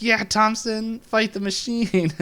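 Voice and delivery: high-pitched imitated crowd cheer. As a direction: egg a friend on, drawing it out. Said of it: "they all start cheering for him".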